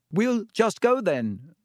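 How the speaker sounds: high, slow, desperate call. A narrator's reading. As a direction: try to back away desperately. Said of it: "Harry called desperately".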